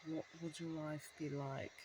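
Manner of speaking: soft, hushed hypnotist line; hushed, hypnotic imitation of the commercial lady; slow and hypnotic. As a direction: breathe it out like a hypnosis ad. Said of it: "he spoke slow and hypnotic".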